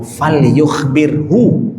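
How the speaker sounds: slow, measured Arabic quote; slow Arabic recitation. Retold or imitated. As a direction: draw out the Arabic hadith slowly. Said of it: "Nabi kasih tahu".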